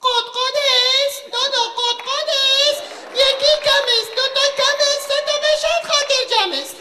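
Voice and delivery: high clucking hen in an Isfahan lilt; Isfahani hen. Pretend. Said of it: "مرغ اصفهانی".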